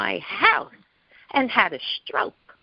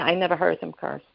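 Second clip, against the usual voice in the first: usual speaking voice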